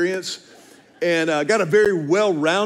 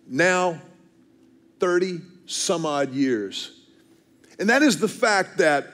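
ordinary talk, nothing marked

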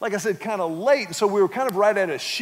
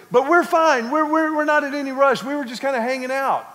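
higher, louder, hurried smoothing over; flustered wife; 5 semitones higher, 3 dB louder than usual. Retold or imitated. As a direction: rush to smooth things over with the waitress. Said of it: "Julie kinda started".

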